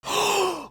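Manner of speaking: a sudden, high, shocked intake of breath; sharp gasp. Real, not acted. Viewer gasps at a shocking reveal, sharp gasp. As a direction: gasp in disbelief.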